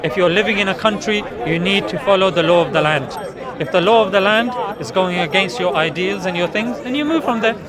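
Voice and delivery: usual speaking voice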